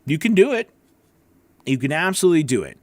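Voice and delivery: ordinary talk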